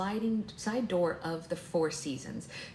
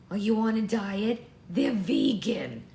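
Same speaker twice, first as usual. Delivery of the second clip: put-on loud, higher, drawn-out old lady; grandma voice; 4 semitones higher, 7 dB louder, slower than usual. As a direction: press food on them as a grandmother. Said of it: "this is just the regular grandma voice".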